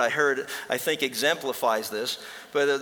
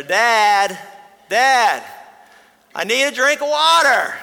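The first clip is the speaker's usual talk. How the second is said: put-on much higher, loud child's whine for water; a small boy pleading from bed, louder each time; boy calling out; 9 semitones higher, 10 dB louder, slower than usual. Boy calling father again.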